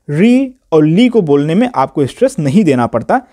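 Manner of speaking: usual speaking voice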